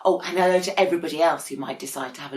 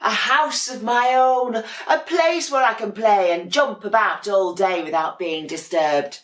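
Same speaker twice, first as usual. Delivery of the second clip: higher and louder, delighted; happy penguin; 6 semitones higher, 6 dB louder than usual. Acted. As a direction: gloat over your own little house. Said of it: "said Dudley".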